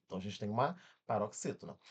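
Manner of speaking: ordinary talk, nothing marked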